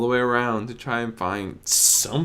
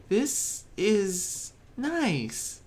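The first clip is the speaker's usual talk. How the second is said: high, slow, murmured; a timid pony voicing relief; Fluttershy's soft voice; 10 semitones higher, much slower than usual. Narrator voices Fluttershy relieved at the calm. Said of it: "she muttered".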